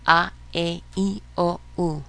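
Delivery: "A, E, I, O, U" as ah, eh, ee, oh, oo; Spanish vowel sounds. Shown as a demonstration. Teacher leading a practice drill, "A, E, I, O, U" as Spanish vowels. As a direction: chant "A, E, I, O, U" in separate pure Spanish vowels. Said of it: "actual sounds of the vowels in the Spanish".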